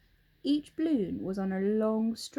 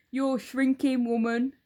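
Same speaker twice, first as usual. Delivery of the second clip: put-on a louder, gloating old husband; Mr Twit; 4 semitones higher, 6 dB louder than usual. Husband taunts his wife, loud and gloating. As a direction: lean in, relishing the lie.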